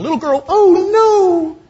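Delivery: very high, loud scared little squeak; mock frightened squeal. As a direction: squeal like a terrified big man. Peter squeaking in fear.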